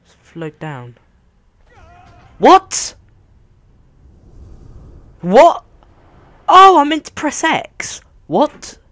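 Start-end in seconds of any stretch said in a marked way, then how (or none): 2.4-8.8 high, loud startled cries. Real, not acted. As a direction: caught off guard by the game. Game surprise, wrong button.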